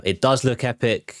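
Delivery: usual speaking voice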